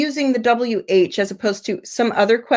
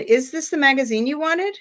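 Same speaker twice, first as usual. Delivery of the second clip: higher pitch, climbing on "wanted"; rising question intonation; 5 semitones higher than usual. Shown as a demonstration. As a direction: ask it with "wanted" swinging upward. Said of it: "So the rising".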